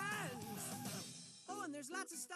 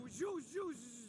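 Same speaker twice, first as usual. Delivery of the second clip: loud whooshing that dies down; mock wind whoosh; 3 semitones lower, 4 dB louder than usual. Narrator acts out the wind, whooshing then fading. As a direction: blow the gale out with your voice. Imitates wind dying away.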